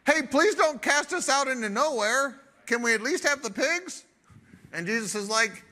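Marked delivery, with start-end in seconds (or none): 0.1-4.1 mock pleading demon. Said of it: "you know what the demon said?".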